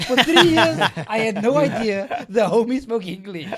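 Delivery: high, shouted disbelief through laughter; laughing, exasperated outburst; loud exasperation. Real, not acted. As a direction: blurt out the punchline, loud and high. Exasperated punchline of own story.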